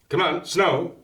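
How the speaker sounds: lightly voiced child urging come on; coaxing kid voice. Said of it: "And he says".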